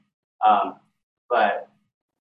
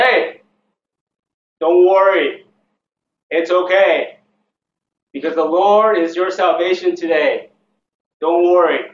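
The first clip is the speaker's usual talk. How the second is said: raised, emphatic leader's voice; loud reassurance; 4 semitones higher, 8 dB louder than usual. Acted. A leader telling the people it's okay, loud reassurance. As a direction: reassure a whole crowd, loud and higher.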